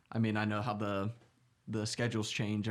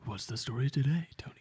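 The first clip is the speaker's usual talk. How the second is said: playful stage whisper to a co-host; whisper tones. Pretend. Co-host asking Tony for today's story, whispered. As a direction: ask it in a hushed, breathy murmur. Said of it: "with subtle whisper tones".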